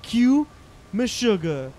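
loud high shout. Pretend. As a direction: yell it out like an announcer. Calling for heavy metal music.

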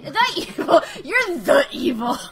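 laughing mock outrage; mock accusation. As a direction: playfully accuse him. Mock accusing after correction.

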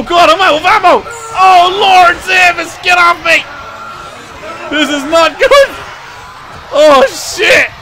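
loud frantic yelling, one line rushed; panicked yelling. Real, not acted. Player swarmed and out of ammo, panicked yelling. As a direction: scream for help as they swarm you.

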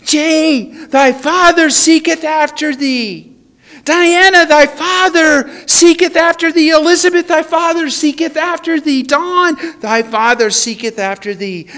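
high, loud call of each name; God calling out. Retold or imitated. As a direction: call every name out with mounting urgency. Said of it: "And He said".

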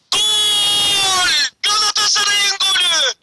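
high shouted goal call; screaming football commentator. Acted.

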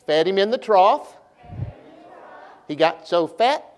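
sing-song lift at the end of each line; pause, then send "trough" and "fat" upward; rising inflection. Shown as a demonstration. Preacher leading children through a poem, rising inflection. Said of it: "my voice goes up, y'all go up".

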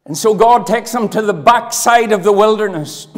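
booming revivalist preacher; preaching at full force. Acted. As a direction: drive it home from the pulpit.

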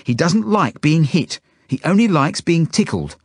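high-pitched indignant protest; yelling. A narrator's reading. Zelda telling the kid off over Trotsky, yelling. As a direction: scold him in a high child's yell. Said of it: "Zelda yells at the kid".

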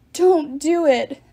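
loud, slow, pleading wail; desperate wail. A narrator's reading. The beaten giant wailing to Bacchus, slow and loud. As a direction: beg for your life. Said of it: "Ephialtes wailed".

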